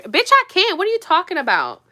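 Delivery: high, loud indignant retort; indignant comeback. Acted. Vlogger answering doubters who say she can't get a man, indignant.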